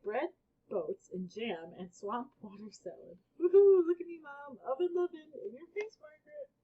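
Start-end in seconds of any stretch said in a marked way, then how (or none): 3.4-6.5 high, loud victory whoops that fade into taunts; wild cheering. A narrator's reading. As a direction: cheer like you just won the grand prize. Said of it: "cheered louder than was necessary".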